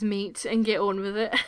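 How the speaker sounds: ordinary talk, nothing marked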